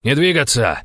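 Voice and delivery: high, sharp shouted command; barked order. A narrator's reading. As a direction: bark it to stop everyone. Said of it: "рявкнул Денис Нестеров". (рявкнуть приказ)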